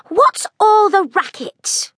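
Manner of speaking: put-on sleepy girl scolding the noise; cross girl's call. A narrator's reading. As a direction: complain from above, annoyed.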